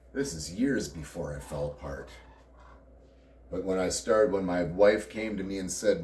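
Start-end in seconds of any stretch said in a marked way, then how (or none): none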